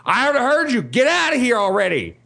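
high-pitched, understated imitation of yelling back; retold mock yell. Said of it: "like, yelling back at him".

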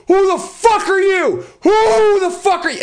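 high, loud hammed-up barking-dog voice; mock yelling dogs. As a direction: play the huge dogs shouting at a stranger, slow and loud. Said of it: "come screaming on the corner, yelling at me".

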